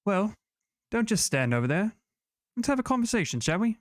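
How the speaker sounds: lightly voiced sly villain; a hint of a taunting sorceress. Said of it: "a voice echoes through all of your heads".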